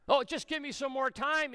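high, urgent mock plea; pleading. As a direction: beg for time, pitched high.

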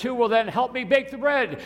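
very high-pitched appeal; storybook hen pleading. A narrator's reading. Tired little red hen asks her friends, high pleading voice. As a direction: lift the question right up, storybook style.

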